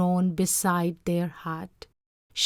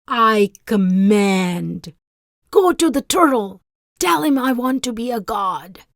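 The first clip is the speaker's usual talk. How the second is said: commanding rage; 3 semitones higher, 9 dB louder than usual. Acted. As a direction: bark the order like a tyrant. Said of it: "His wife raged".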